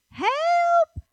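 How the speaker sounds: very high, loud holler; yelling real loud. Retold or imitated. Resident interrupts her helper's plea not to yell, loud high yell. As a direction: imitate a Southern lady hollering for help. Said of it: "she's yelling real loud".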